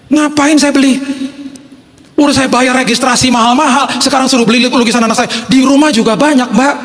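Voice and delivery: high-pitched, put-out complaining; indignant protest. Retold or imitated. Father refuses to buy his own child's painting, high and indignant. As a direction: protest it high, like you're being cheated.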